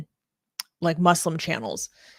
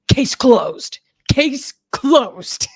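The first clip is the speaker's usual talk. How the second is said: loud, hammed-up verdict in a put-on voice; triumphant mock declaration; 6 semitones higher, 7 dB louder, much slower than usual. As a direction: boom it out, mimicking his finality. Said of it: "And he's like".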